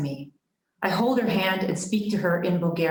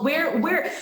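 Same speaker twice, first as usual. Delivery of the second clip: high, searching cry; storyteller's touch of alarm; mild narrated panic; 7 semitones higher than usual. A narrator's reading. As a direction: ask in higher-pitched bewilderment.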